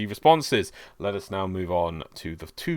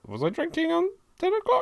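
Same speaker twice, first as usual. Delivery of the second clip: surprised. Real, not acted. Alarmed he was drunk on air.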